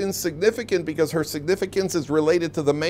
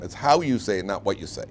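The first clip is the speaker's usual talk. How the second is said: ordinary talk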